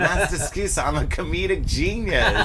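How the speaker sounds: high, laughing goofy comic voice; laughing through a hammed-up Jerry Lewis; Jerry Lewis impression. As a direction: ham it up like a zany comedian. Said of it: "He's like".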